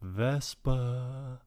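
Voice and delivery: slow, soft, drawn-out fatherly call; mock ghostly father's voice. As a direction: gently call out to a daughter, stretching the words. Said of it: "you just hear her father".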